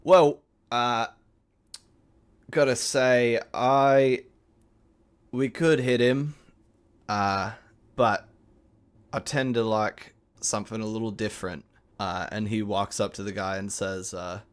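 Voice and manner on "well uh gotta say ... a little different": raised, louder Cockney character voice; Cockney, halting between phrases; Cockney accent. Acted. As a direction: mull it over in broad Cockney. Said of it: "I'm doing a Cockney accent".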